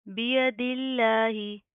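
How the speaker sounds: बियदिल्लाही drawn out slowly with a thin, light lam; slow chanted Quranic recitation. Shown as a demonstration. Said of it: "लफ्ज़ अल्लाह को बारीक पढ़ेंगे".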